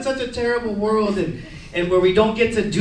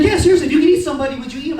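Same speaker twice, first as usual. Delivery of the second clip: higher, rushed repeat of a question; quick insistence; 5 semitones higher, faster than usual. Retold or imitated. Stepdad insisting he means the question, quick and earnest. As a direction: press the question fast, pitched up.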